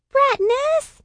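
put-on very high, loud little-girl squeal; shrieky high child voice, rising at the end; hissed by little sister. A narrator's reading. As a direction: squeal it indignantly like an embarrassed kid sister. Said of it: "she hisses".

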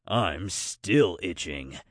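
low, slow creature voice; Snowclaw grumbling. Acted. Snowy complains after the mosquito jungle, low slow grumble. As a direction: grumble slowly, pitched well down.

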